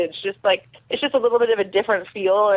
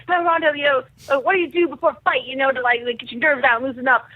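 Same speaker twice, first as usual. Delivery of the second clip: high, lightly put-on Australian director; mock Australian accent; 4 semitones higher than usual. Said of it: "he's got this Australian accent".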